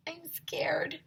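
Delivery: small frightened whisper; scared whisper. Acted. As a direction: breathe it out afraid. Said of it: "said Emily".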